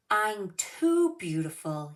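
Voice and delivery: slow, loud haughty fish; vain fish. Acted. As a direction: let the fish boast about himself. Vain fish voiced in story.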